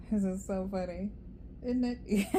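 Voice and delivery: real amusement breaking into laughter; laughing. Real, not acted. Tarot reader amused by a repeated Cancer card, laughing. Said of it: "y'all gonna see why I'm laughing".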